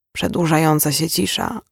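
plain reading voice

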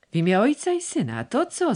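startled cry, pitch jumping high; astonished old man crying out; shouted exclamation. A narrator's reading. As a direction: call out in alarm, voice leaping up. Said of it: "zakrzyczał".

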